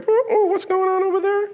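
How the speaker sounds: high nosy neighbour peeking over; nosy eavesdropper. Retold or imitated. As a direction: lean in like a snoopy seatmate. Mimics nosy plane passengers.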